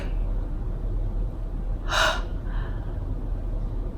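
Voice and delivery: long breathy gasp of shock; sharp gasp. Retold or imitated. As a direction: gasp in disbelief. Learning she is pregnant.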